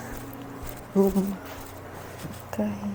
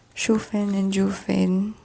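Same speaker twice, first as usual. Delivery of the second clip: usual speaking voice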